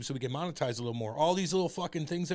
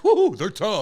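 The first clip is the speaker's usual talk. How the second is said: mocking; 6 semitones higher, 11 dB louder, slower than usual. Mocking TV tough guys.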